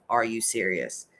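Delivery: a hint of an irritated husband; imitating annoyed disbelief. Retelling her husband's scolding, low and unimpressed. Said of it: "I got the double".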